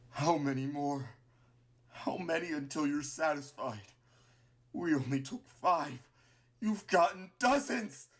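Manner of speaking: grind out words through pain, building; pained whimper. A narrator's reading. Dying Martlet accusing Clover, pained and grinding. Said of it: "She whimpers in pain briefly, only enough to grind out a few words".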